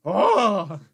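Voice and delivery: loud, high gasp of recognition; sudden delighted surprise; surprised. Real, not acted. Toy triggers nostalgia.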